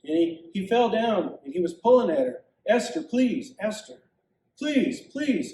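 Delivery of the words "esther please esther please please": high, pleading cries; desperate begging. Acted. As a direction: beg for your life. Haman begs the queen.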